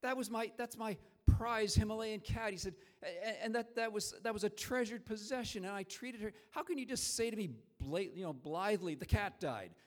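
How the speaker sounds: a father hurt and scolding his son; upset father. Retold or imitated. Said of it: "He was really upset".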